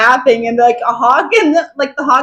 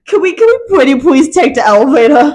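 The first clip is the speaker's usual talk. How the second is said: pleading; 4 semitones higher, 5 dB louder than usual. Retold or imitated. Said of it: "They're like".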